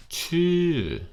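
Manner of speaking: chu high and falling with a tightened throat; high falling chu from a tight throat. Shown as a demonstration. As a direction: squeeze the throat on chu and drop it. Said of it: "you tighten your throat up right here".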